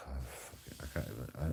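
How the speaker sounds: hushed, breath-heavy struggle for words; real distress, half-swallowed words; choked up. Real, not acted. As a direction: barely get it out, winded by the memory. Struggling to say the accusation.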